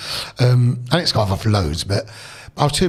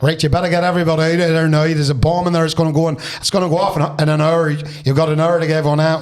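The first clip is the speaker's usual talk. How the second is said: urgent mock Northern Irish caller, louder and fast; Northern Irish accent; 3 semitones higher, 4 dB louder than usual. Prank caller phoning the school, Northern Irish accent. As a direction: put on a Northern Irish accent for a hoax warning. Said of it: "put my best Northern Irish accent".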